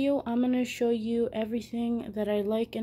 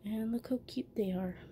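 whispering; 6 dB quieter than usual. Real, not acted. Cooing over her turtles.

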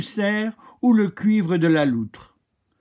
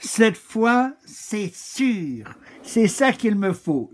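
higher, eager exclamation; excited cry; 7 semitones higher, slower than usual. Acted. Lady Chameleon exclaims at the parrot's red, eager and higher. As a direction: sure of herself at last. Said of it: "s'écria dame Caméléon".

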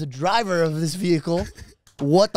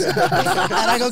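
laughing; 13 semitones higher, 4 dB louder, slower than usual. Real, not acted. Laughing while retelling.

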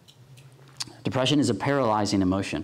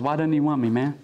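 mild acted hurt, voice raised in pitch; imitated tearful plea; 4 semitones higher than usual. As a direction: plead like someone starting to cry, understated. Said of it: "and he starts crying".

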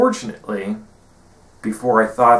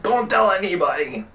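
higher, urgent don't-tell plea; hushed plea; 9 semitones higher than usual. Retold or imitated. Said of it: "Azula's like".

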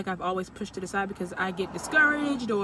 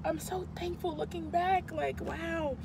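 higher and softer, choked up; teary gratitude; 7 semitones higher, 5 dB quieter than usual. Real, not acted. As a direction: marvel through tears. Emotional gratitude, still teary.

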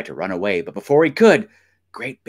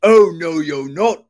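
loud, higher troll refusal; forceful troll, raised voice; thundering troll; 6 semitones higher, 6 dB louder than usual. A narrator's reading. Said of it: "thundered the troll".

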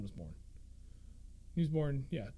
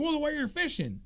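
much higher, prissy complaint; snooty homeowner; 10 semitones higher than usual. Retold or imitated. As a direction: whine it like a fussy neighbor. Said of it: "He's like".

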